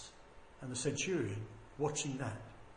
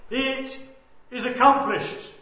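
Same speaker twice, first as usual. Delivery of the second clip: loud, measured proclamation; victorious cry; 8 semitones higher, 14 dB louder, slower than usual. Retold or imitated. Preacher voicing Jesus' last cry of victory, crying out. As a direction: cry it out in triumph. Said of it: "he cried out from that cross".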